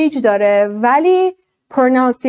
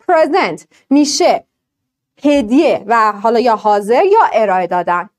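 ordinary talk

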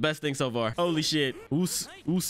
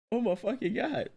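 higher-pitched disbelieving gasp of words; emotional amazement; 8 semitones higher than usual. Real, not acted. Fan getting emotional at the clean animation, high amazement. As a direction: lift the pitch, overcome with amazement.

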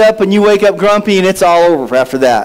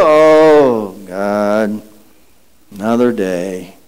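groaning grump; 13 semitones lower, much slower than usual. Acted. Grumpy waker dreading the morning, low weary groan. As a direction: drag yourself out of bed with it. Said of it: "put your foot on the floor and go".

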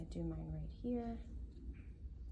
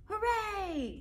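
mock cheer; 13 semitones higher, 5 dB louder than usual. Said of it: "yelled Molly".

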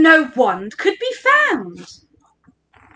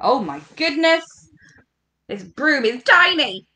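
ordinary delivery

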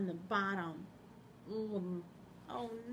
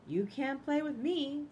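high, slow Piggy refusal; 6 semitones higher, 4 dB louder than usual. Acted.

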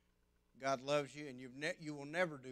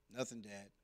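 put-on sulky boy; 5 semitones lower, 7 dB quieter than usual. Sulking boy answers dad.

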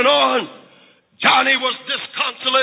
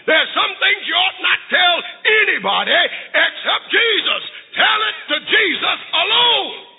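preaching that climbs higher with each repeated line; 5 semitones higher than usual. Real, not acted. Preacher urging congregation to tell it to Jesus, fervent preaching. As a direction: build to an impassioned, high-pitched pulpit cry.

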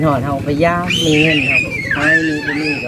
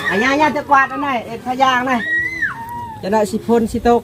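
high raised voice asking across the house; pitched-up shout to grandma; calling out to grandma; 10 semitones higher than usual. Real, not acted. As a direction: call out so grandma hears.

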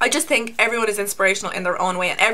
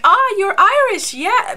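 high, loud, slow mock-delighted foreigner; imitating foreigners; 11 semitones higher, 6 dB louder, slower than usual. Imitating people abroad delighted she is Irish. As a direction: coo it like an excited tourist. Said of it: "people are like".

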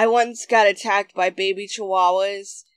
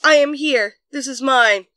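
insistent bird; 5 semitones higher, 6 dB louder than usual. Acted. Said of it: "constantly repeating".

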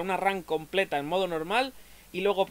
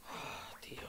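breathy whisper of defeat; 18 dB quieter, slower than usual. Real, not acted. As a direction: whisper it in disbelief. After losing again.

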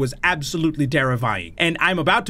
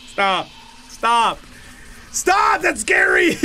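fear building; 6 semitones higher, slower than usual. Real, not acted. Scared gamer begging the game to stop, rising to a yell. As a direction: build from a mutter to a scream.

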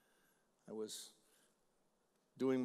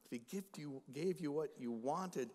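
ordinary delivery

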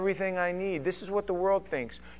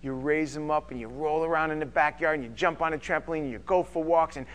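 ordinary delivery

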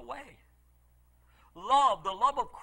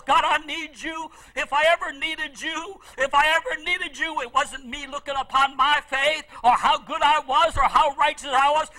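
louder, higher pleading; desperate prayer; 6 semitones higher, 9 dB louder than usual. Retold or imitated. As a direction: cry out as if everything depends on it. Retold desperate prayer for son.

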